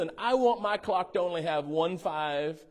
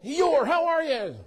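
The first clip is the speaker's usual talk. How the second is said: high, loud, bouncy Tigger call; 7 semitones higher, 8 dB louder, slower than usual. Retold or imitated. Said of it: "he's tigging all over the place and he's bouncing".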